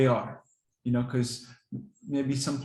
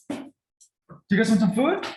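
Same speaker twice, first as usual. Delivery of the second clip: much higher and louder, slow stretched excited offer; even more hyped food call; 9 semitones higher, 7 dB louder, slower than usual. Shown as a demonstration. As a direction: drag it out to wind them up further. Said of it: "maybe I'll give you an example".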